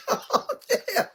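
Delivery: squeaky high mock plea; mocking calm down. Friends begging Precious to calm down, squeaky mock plea. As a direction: pipe it up like teasing the crowd.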